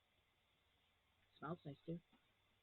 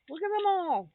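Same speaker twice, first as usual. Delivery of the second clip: excited; 8 semitones higher, 9 dB louder than usual. Real, not acted. Showing hooks, louder repeat.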